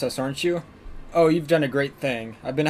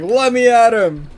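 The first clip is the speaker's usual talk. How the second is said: eager fighter voice; 8 semitones higher, 8 dB louder, slower than usual. Retold or imitated.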